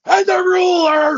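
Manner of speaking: high, slow wrestling promo yell; loud promo shout. Retold or imitated. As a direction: explode into the boast. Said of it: "the quiet, loud thing".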